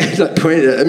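ordinary talk

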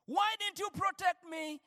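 normal talking voice

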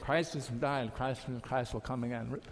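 just going through the motions; mumbled by rote. Shown as a demonstration. Preacher mocking a half-hearted liturgy response, mumbling. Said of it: "That's not the point".

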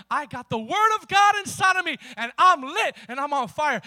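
loud, high fired-up declaration; punchy, exultant preacher's shout; full-force preaching. Real, not acted. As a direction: testify at full volume.

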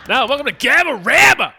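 big high show-opener shout; announcer shout. Acted. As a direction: welcome them like a game show host. Show intro, announcer call.

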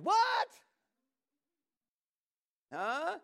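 sudden shouted high what; shocked what. Real, not acted. Preacher reacting to the verse with a shocked shout. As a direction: leap up in disbelief.

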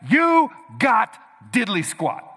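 loud, punchy declarations; preacher driving the point to the congregation, loud and emphatic; full-force preaching. Acted. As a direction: hammer each line home.

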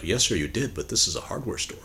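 soft, dry punchline delivery; deadpan cashier. Retold or imitated. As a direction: let the punchline drop quietly. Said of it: "the cashier's like".

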